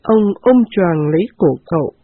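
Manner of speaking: plain reading voice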